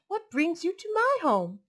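high, loud surprised question; exclaiming. Acted. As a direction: ask in happy surprise. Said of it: "Omu exclaimed".

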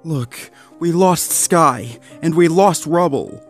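pained grief. A narrator's reading. Rocky reminds Zuma of their dead friends, pained. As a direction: hold back pain on each word. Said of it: "Painful emotion filled his eyes".

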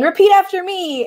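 lightly voiced, high-pitched parrot leader; counselor parrot. A narrator's reading. Counselor parrot leading a sing-along, lightly singsong. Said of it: "a counselor parrot".

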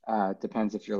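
normal talking voice

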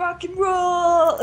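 high, drawn-out cheer through laughter; Ted, whooping. Retold or imitated. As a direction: imitate a gleeful rock-and-roll cry, stretched out. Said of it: "Oh, that's what he was".

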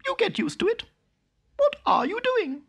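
very high mouse voice, looking down on it; snooty town mouse. A narrator's reading. Town mouse sneering at her cousin's hedge, very high. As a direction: pipe it up high like a prim little mouse.

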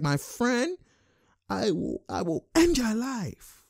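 high, soft mock threat; slow down into a hushed, high-pitched menace; Ibaka. Streamer voices the player warning his opponent, soft high threat. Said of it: "Ibaka's like".

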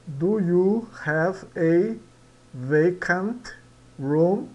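slow "Do you have a vacant room?" with a rising end; teacher modelling the question; model English reading. Shown as a demonstration. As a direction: read the question slowly, rise at the end. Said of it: "Você tem um quarto livre?".

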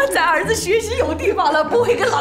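loud, high, laughing relief; elated. Acted. Wife rejoices that their son has room to study, elated. Rejoicing over the new flat.

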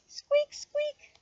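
very high, thin squeaks; mouse squeaking. A narrator's reading. Reader imitates mouse squeaks.

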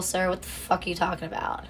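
ordinary talk, nothing marked

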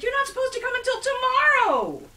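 sustained high, loud shriek; panicked screeching objection. A narrator's reading. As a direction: shriek in protest to the very end. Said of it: "Herschel shrieked".

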